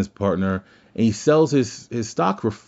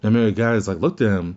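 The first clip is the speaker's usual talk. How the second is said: ordinary talk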